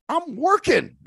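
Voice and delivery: very high-pitched, put-upon protest; mock indignation at being disturbed; indignant protest. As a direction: act out protesting an interruption, voice shooting up. Retells protest at being interrupted.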